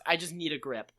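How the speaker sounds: ordinary talk, nothing marked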